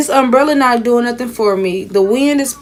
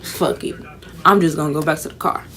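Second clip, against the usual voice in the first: muttered give-up; 6 semitones lower, 5 dB quieter than usual. Retold or imitated. Giving up, going back to car.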